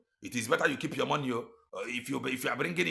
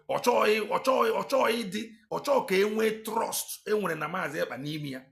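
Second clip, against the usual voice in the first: higher, louder mocking rant; 7 semitones higher, 4 dB louder than usual. Real, not acted. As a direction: jeer at him in a raised voice. Mocking high rant about minister.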